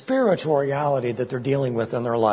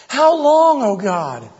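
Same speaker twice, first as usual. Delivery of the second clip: much higher and louder, a desperate cry; anguished cry; 8 semitones higher, 6 dB louder, slower than usual. Acted. Preacher crying out as David to God, loud anguished wail. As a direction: wail it up to heaven in agony. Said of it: "Can you hear it in his voice?".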